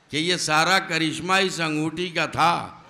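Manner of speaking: ordinary talk, nothing marked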